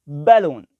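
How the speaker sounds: बैलून said slow and loud, pressed out for the class; careful word demo. Shown as a demonstration. Teacher models the word for beginners, clear and careful. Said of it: "word है बैलून".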